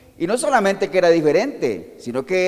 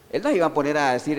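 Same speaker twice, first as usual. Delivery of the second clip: normal talking voice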